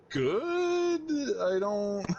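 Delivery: put-on a confused girl squeaking her answer up high; confused reply. Cosplayer answering the screen, confused high squeak.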